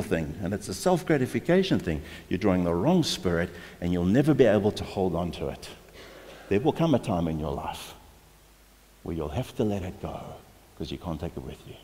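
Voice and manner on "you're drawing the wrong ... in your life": usual speaking voice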